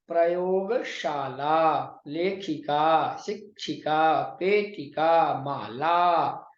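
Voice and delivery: very slow, each word drawn out syllable by syllable; pronunciation drill. Shown as a demonstration. Teacher reading the exercise words for students, careful slow pronunciation. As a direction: model each word with long, held final vowels. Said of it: "उच्चारण कुरुता".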